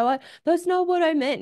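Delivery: mock-offended defensive wife. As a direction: snap back defensively, voice jumping up. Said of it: "I got defensive".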